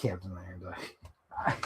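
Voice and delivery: ordinary delivery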